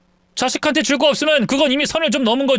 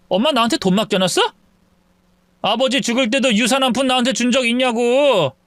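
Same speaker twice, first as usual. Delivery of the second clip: ordinary delivery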